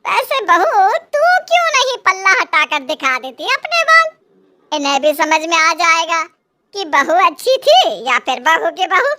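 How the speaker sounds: a hint of a scornful saas; sarcastic mother-in-law. A narrator's reading. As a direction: needle the old woman with mockery. Mother-in-law figure voiced.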